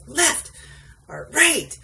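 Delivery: sudden high yell of directions; a passenger suddenly shouting directions; retold scream. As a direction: blurt the turn loud right in his ear. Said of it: "she would scream in my ear".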